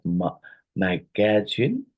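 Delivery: "magazine" drawn out in separate syllables. Shown as a demonstration. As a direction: break "magazine" slowly into its syllables. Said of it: "how many syllables are in the word magazine".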